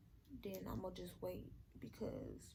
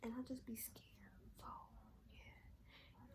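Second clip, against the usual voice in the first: whispering; 2 semitones higher, 10 dB quieter, slower than usual. Real, not acted. Admitting fear, fading whisper.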